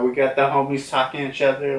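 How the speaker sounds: usual speaking voice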